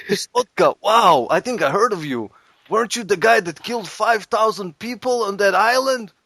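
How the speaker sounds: high-pitched, awed fellow inmate; impressed prisoner. Acted. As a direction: gush at a notorious newcomer. Voices impressed prisoner meeting Boris.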